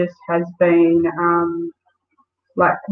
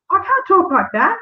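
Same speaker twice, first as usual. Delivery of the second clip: high-pitched, alarmed retelling of a nervous boss; mock panic from a boss who dreads the phone call; panicked protest; 13 semitones higher than usual. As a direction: squeak it out like a deer in headlights. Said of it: "looked at me and goes".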